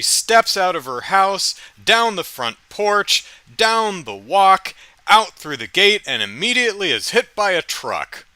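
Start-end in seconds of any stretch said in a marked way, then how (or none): 3.6-4.7 sing-song. Acted. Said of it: "bubbly, happy high school kid".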